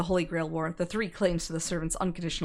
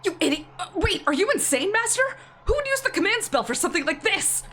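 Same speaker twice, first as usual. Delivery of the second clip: exasperated shouting; 11 semitones higher than usual. Acted. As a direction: scold her in disbelief. Servant berates his master.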